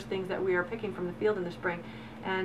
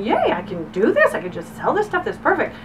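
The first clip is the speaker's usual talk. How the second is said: higher, louder gleeful retelling; excited; 5 semitones higher, 6 dB louder than usual. Retold or imitated. Farmer realising she can sell her beans, excited. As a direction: cheer to yourself with leaping pitch. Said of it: "I got all excited thinking".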